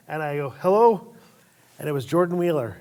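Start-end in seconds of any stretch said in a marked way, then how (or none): 0.6-1.1 raised, loud phone greeting; loud hello. Retold or imitated. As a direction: answer the phone with a burst. Answering phone.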